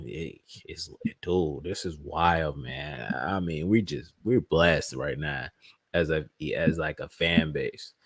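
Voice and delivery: low, soft and slow; quiet awe. Real, not acted. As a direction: murmur it, humbled by the blessing. Quiet awed reflection on fans.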